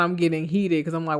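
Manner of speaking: ordinary talk, nothing marked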